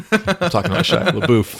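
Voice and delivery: real laughter bursting through the words; laughing. Real, not acted. Host laughing off his cohost's tease, real laughter.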